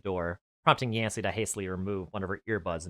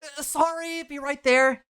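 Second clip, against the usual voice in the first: calling back; 4 dB louder, slower than usual. Acted. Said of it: "Yancy called back to the door".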